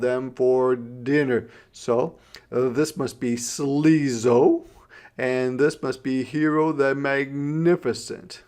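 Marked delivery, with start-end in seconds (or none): none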